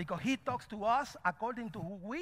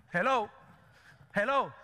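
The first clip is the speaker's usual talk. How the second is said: loud, punchy wake-up call; 5 dB louder than usual. Real, not acted. Preacher to congregation after a hard point, loudly calling for attention. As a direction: call out to rouse the listeners.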